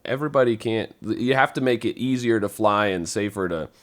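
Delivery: ordinary talk, nothing marked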